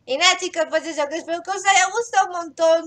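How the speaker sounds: bright, upbeat sign-off; vlog outro. Acted. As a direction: wrap up cheerfully for viewers. Youtuber outro.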